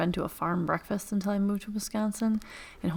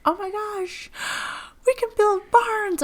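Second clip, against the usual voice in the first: much higher, squealing retold delight; high mock-thrilled gasp; excited colleague; 3 dB louder than usual. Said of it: "She's like".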